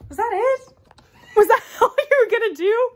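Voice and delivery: loud, high, laughing incredulity; laughing disbelief. Real, not acted. Host teasing partner over the little act, laughing. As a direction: break into laughter while asking.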